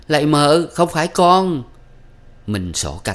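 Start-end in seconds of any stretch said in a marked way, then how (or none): 0.1-1.6 high, loud wailing denial; wailing cry. A narrator's reading. Beaten servant girl wailing to her mistress, high and loud. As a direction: cry out in pain and terror. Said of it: "Con Sen càng kêu gào".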